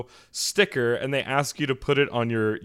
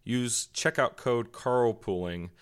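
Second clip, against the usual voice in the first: sponsor ad read; 6 dB quieter than usual. Real, not acted. Podcast host reads fitness supplement sponsor to listeners, ad read. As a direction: read the sponsor copy evenly and quietly.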